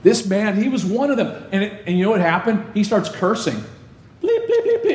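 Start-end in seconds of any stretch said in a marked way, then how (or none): 4.2-5.0 very high mock bleep tone; censor bleep. Pastor imitates Peter's cursing being bleeped out, high bleep noise. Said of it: "It had to get bleeped out".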